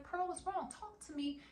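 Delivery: lightly voiced worried in-law; high concerned sister-in-law.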